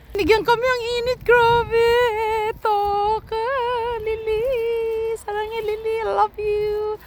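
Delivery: put-on very high, sweet baby voice; cutesy baby talk. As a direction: coo up high like talking to a little one. High squeaky voice to pet/baby. (malambing na boses-bata)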